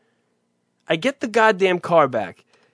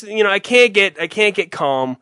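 ordinary delivery

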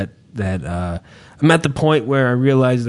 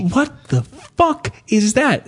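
slower, stunned repeat of the stranger's line; bewildered shopper again; 7 semitones higher, slower than usual. Retold or imitated. Man with a full cart blurting at the wife's moves, drawn-out stunned voice. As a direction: drag it out like the stranger staring. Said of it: "I heard him say".

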